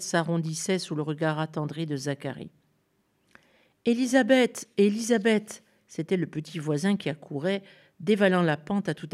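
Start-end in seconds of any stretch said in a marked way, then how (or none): 3.9-4.7 high, loud call across the hill; child calling a neighbour's name. Acted. Boy calls from the slope. 4.8-5.6 put-on child calling again while running. Boy calls again, running.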